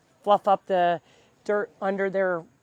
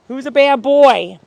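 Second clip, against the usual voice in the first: slow, loud mock scold; 5 semitones higher, 14 dB louder than usual. Real, not acted. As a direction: tease the dog as if scolding. Scolding the muddy dog.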